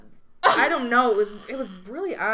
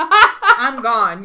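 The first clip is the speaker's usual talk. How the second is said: laughing; 13 dB louder than usual. Real, not acted. Laughing at the absurd scene.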